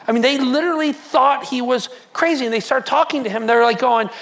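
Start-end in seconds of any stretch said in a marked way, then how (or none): none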